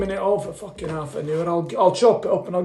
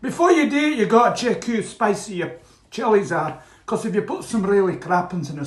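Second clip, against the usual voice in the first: ordinary delivery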